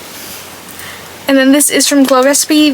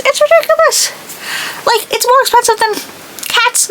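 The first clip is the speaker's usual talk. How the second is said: much higher squealed ridiculous; exaggerated outrage; 12 semitones higher than usual. Real, not acted.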